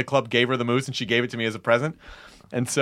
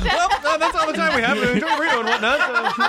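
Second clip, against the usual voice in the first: high-pitched laughing sign-off; 14 semitones higher, faster than usual. Real, not acted. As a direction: wrap it up while cracking up.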